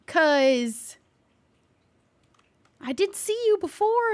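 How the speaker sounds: ordinary delivery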